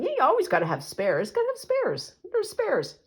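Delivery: half-hearted mock mumble, rising to a high voice at the end; mock mumbling agreement. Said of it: "mumble off into the sunset going".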